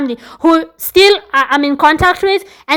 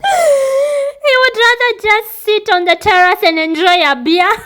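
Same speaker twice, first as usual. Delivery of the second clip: high, laughing through the words; 7 semitones higher than usual. Real, not acted. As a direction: giggle while you say it. Laughing about husband's beer.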